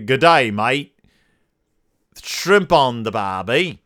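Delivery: put-on Australian catchphrase voice; Australian accent. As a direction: do a hammed-up Aussie accent. Hammed Australian catchphrases after Australia segment.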